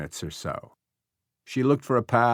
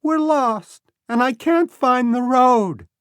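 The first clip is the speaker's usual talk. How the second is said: put-on high, loud child's voice; lost boy; 7 dB louder, slower than usual. A narrator's reading. Lost boy calling from grass.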